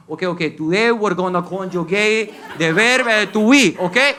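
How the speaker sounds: high, singsong Colombian English teacher; Colombian teacher accent. Retold or imitated. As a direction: sing out the drill like a teacher. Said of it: "a professor that walks in".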